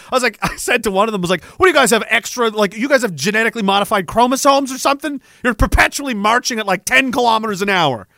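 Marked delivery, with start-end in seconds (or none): 1.4-8.1 higher, louder disbelieving complaint; worked-up joking rant at tall teammates; exasperated rant. Retold or imitated. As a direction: marvel at them, half laughing, half outraged.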